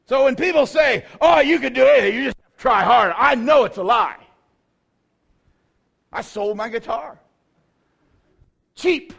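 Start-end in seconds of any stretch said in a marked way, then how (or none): 0.0-4.2 loud, higher preaching denunciation; full-force preacher's outcry; preaching shout. Real, not acted. Preacher denouncing a saying. 8.8-9.1 a much higher squeak on cheap; high comic yelp on the punchline. Real, not acted. Punchline about selling guitar.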